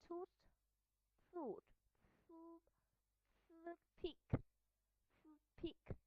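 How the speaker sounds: "food, pick" said as toothpick, with a long voiceless TH; TH mid-word. Shown as a demonstration. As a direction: hold the airy TH before "pick". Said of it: "in the middle of the word".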